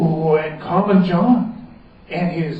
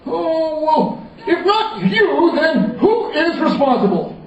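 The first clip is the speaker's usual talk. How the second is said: higher, urgent royal question; pressing king; 11 semitones higher than usual. Acted. The king presses the wizard, urgent. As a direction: press him for an answer.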